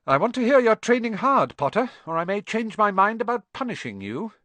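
high, loud stern teacher; teacher warning a pupil sternly. Acted. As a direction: warn him firmly. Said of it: "peered sternly over her glasses".

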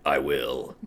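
low demonic menace. Acted. Demon McQueen speaks.

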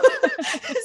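a sudden high, loud laugh; high burst of laughter. Real, not acted. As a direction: laugh out loud at your own joke. Laughing at her own joke.

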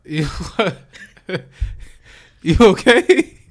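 high, loud teasing laugh. Real, not acted. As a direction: tease her through a laugh. Teasing friend who is tearing up.

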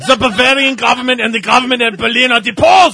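hammed-up German accent. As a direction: bark it like a cartoon German dictator. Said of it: "read that entire thing in an accent".

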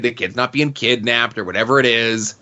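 usual speaking voice

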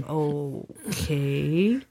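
low, quiet, drawn-out okay; a hesitant, stretched "okay" under the breath; uneasy and hushed; awkward okay. Acted. Awkward reaction to weeping friend.

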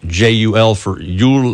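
J U L spoken letter by letter; name each letter: J, U, L; spelling it out. Shown as a demonstration. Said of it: "It's spelled Godt".

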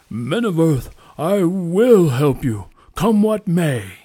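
slow, loud and proud; bold proud declaration. A narrator's reading. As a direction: proclaim it boldly, slow and strong. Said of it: "spoke boldly and proudly".

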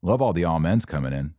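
ordinary talk

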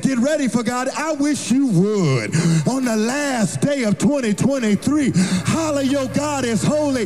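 high, chanted preacher's whoop, building to a shout; preaching at full force. Acted. Pastor whooping to the congregation on the last Sunday, sung and shouted. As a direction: ring it out over the organ like a Sunday climax.

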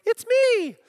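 goofy dad faking a strange high voice; silly disguised voice. Retold or imitated. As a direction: disguise your voice as a joke to fool the kids. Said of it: "I don't disguise my voice".

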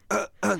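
short high gasps, faking a choke; covering gasps. Retold or imitated. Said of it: "ごまかそうと思ってさ".

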